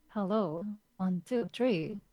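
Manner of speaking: ordinary delivery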